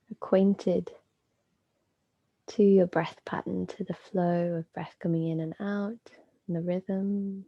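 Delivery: calm, unhurried yoga nidra guidance with long pauses; measured meditation voice, phrases spaced out; guided meditation. Acted. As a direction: guide them inward, leaving space between phrases.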